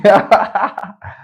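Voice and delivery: high, loud giggle; real laughter. Real, not acted. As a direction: burst out giggling mid-sentence. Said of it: "I'm sorry for laughing".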